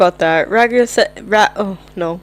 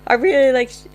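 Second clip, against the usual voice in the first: voice cracking; 4 semitones higher than usual. Real, not acted. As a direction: voice cracks on the word. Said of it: "oh, my voice cracked".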